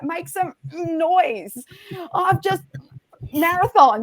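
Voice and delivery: high, stretched-out hype call; animated crowd-rousing. Retold or imitated. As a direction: re-enact whipping up a crowd. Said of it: "I'm really animated".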